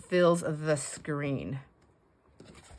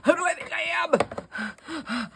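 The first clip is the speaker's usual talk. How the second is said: much higher, slow despairing whine; hammed-up despair, pitched up; mock despair; 11 semitones higher, slower than usual. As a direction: plead it high and drawn out, near tears in jest. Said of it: "I'm so upset".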